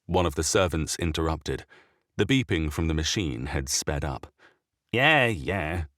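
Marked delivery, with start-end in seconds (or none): 4.9-5.9 dismissive. A narrator's reading. Bishop dismisses servant.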